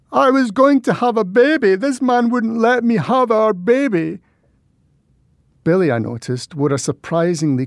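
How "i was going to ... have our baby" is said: put-on high, loud drunken wailing; crying. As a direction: wail it out through tears. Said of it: "the spectacle of Carol crying".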